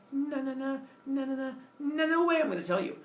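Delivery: high, singsong no-no-no; playful mock defiance; taunting refusal. Prometheus taunts Zeus with refusal.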